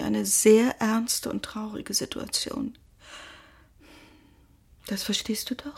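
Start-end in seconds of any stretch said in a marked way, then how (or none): 4.9-5.8 soft whispered question; a tender hushed unvoiced question; softly whispered. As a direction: whisper it gently, seeking his agreement. Mother comforting son about father.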